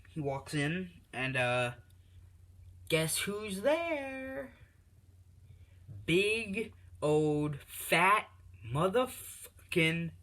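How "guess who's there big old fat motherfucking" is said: high, very slow drawn-out hype; dramatic announcer buildup. Acted. Narrator teasing the listener before the reveal, drawn-out hype. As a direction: stretch every word like a ring announcer.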